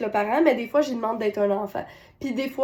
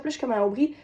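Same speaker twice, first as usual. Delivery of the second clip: ordinary delivery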